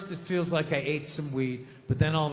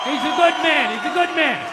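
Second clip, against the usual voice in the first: loud praise; 8 semitones higher, 8 dB louder than usual. Real, not acted.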